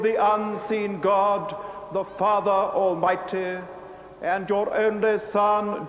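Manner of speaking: ordinary delivery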